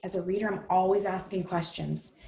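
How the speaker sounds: ordinary delivery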